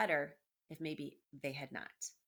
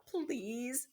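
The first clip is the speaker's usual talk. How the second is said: mild pleading shout; 6 semitones higher than usual. Acted. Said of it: "shouted Despero".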